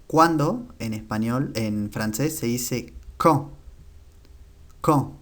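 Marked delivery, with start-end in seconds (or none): 3.2-3.5 quand said slowly, final D silent, nasal vowel; French quand. Shown as a demonstration. Teacher models quand for Spanish learners, D silent. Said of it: "La última D no se pronuncia". 4.8-5.1 quand with a silent final D and a nasal vowel; French quand. Shown as a demonstration. Teacher repeats quand for learners, no final D. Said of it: "La última D no se pronuncia".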